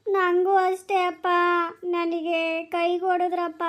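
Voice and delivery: slow, drawn-out sorry complaint; pained whimper. Acted. Child tells father about hurt hand, slow whimper. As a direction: whimper slowly for sympathy.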